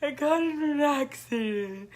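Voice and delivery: high teary voice; crying. Retold or imitated. Calling her mom, a high tearful confession. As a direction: break down as you tell her. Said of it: "I start to bust out, like, crying".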